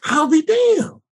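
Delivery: an impressed frat brother, high and astonished; amazed frat brother. Retold or imitated. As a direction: gasp it out in disbelief. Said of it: "they was just like".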